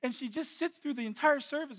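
loud and high, building fervour; loud, high sermon delivery, rising passion; preaching at full force. Acted. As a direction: preach it out to the whole room.

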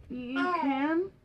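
tentative storybook hopefulness; hopeful. A narrator's reading. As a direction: ask with a touch of hope. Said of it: "He sounded hopeful".

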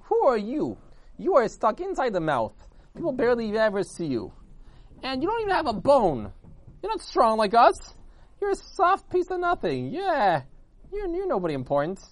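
loud, high-pitched belittling; high, scornful, emphatic put-down; mocking taunts. A narrator's reading. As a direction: jeer to put someone in their place. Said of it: "making fun of the tongue".

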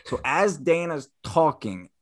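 ordinary delivery

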